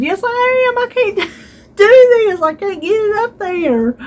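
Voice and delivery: high, strained, laughing through the effort; strained laughing reply. Retold or imitated. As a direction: squeak it out like you can barely hold on. Strained reply while struggling.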